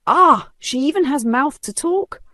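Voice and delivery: high, mocking scoff. A narrator's reading. Vanessa scoffing at the old woman to the crowd. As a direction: sneer at her nerve. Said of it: "Vanessa scoffed".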